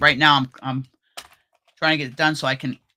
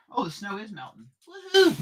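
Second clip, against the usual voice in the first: excited; 7 semitones higher, 5 dB quieter than usual. Real, not acted. Excited about melting snow.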